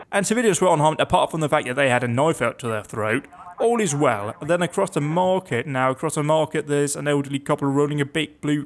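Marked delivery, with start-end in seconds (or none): none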